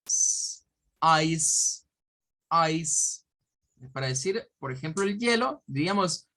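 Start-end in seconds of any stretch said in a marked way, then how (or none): 0.0-0.6 sustained voiceless "sss"; hissed S sound. Shown as a demonstration. Teacher showing how final C sounds, hissed S. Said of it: "va a sonar como una S". 1.0-3.2 EYES said as "ais", ending in a hissed S; isolated model of EYES, "ais"; English pronunciation demo. Shown as a demonstration. Teacher sounding out the phoneme for students, clear demo. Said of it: "es como decir eyes".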